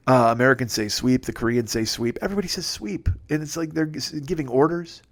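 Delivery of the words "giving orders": ordinary talk, nothing marked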